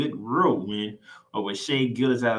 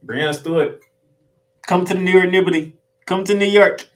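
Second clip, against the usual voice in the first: loud pleading call, higher; 7 semitones higher, 8 dB louder than usual. Pretend. Fan calling to Breanna Stewart to sign, loud plea. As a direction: beg her to come, voice up and loud.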